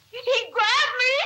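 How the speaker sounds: the fear takes over on the repeat; panicked. Real, not acted. Retelling the attack, rising panic.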